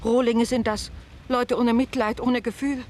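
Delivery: loud indignant tirade, voice pushed up; furious outburst. Acted. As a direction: berate the guests at full volume. Landlady rails at the guests. (wütend und laut schimpfen)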